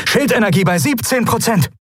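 high, alarmed call; battle alarm report, pitched high; urgent shouted report. A narrator's reading. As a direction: shout the status to the captain. Damage report during battle.

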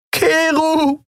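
very high, wailing cry; screaming. A narrator's reading. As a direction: wail the name after him in despair. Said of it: "hustete und schrie". (weinend, verzweifelt schreien)

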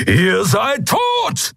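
slow, high-pitched roar; thundering. A narrator's reading. Keru threatens the captors, thundering. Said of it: "donnerte Keru".

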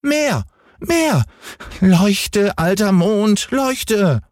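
sehr hohe Kinderstimme, drängend, nicht lauter; fordernder Häwelmann. A narrator's reading. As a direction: ein kleiner Junge kommandiert den Mond. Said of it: "schrie Häwelmann". (sehr hohe, fordernde Kinderstimme)

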